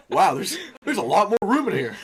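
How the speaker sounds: loud, high mock amazement; hammed-up quote. Joking to friends with a retold quote, hammed-up amazement.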